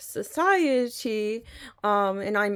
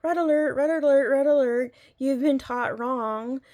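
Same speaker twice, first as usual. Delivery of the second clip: higher and louder, a blaring inner alarm; alarm in my head; 5 semitones higher, 5 dB louder than usual. Retold or imitated. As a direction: sound the alarm going off in your brain. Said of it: "a red alert kinda went off in my brain of".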